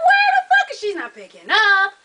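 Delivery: loud, high retold frustration; exasperated outburst. Caller fed up with an unanswered phone, exasperated.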